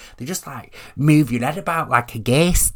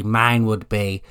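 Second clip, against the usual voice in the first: ordinary talk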